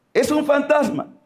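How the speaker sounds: high-pitched acted cry of alarm; cry of terror. Apostles mistaking Jesus for a ghost, acted cry of terror. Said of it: "Y daban gritos de terror".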